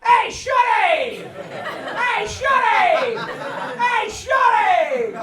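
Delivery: high, slow drawn-out call down the block; street holler. Retold or imitated. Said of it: "every morning at five A M I hear this".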